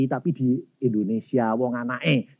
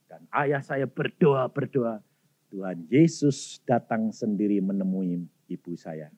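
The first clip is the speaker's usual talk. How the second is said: usual speaking voice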